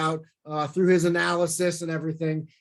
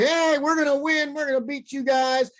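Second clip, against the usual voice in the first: gloating fans; 8 semitones higher than usual. Retold or imitated. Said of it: "And they were like".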